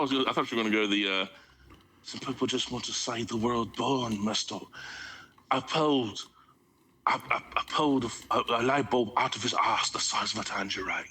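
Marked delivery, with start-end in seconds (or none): 2.0-11.0 lightly voiced bad Michael Caine, lower; a hint of a Cockney butler, nearly laughing; mock Michael Caine as Alfred, breaking into laughter; Michael Caine impression. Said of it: "That's a terrible Michael Caine".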